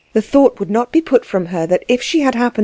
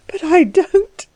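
high, tearful protest; acted sobbing woman; 10 semitones higher, slower than usual. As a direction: sob it out, pitched high. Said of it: "she sobbed".

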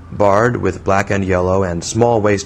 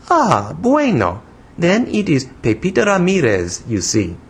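put-on high, childish foreign-accented English; broken English in a little-girl pitch; broken English, infantine; 9 semitones higher than usual. A narrator's reading. Young woman scolding the man who startled her, childish foreign accent. Voices Pepita in dialogue.

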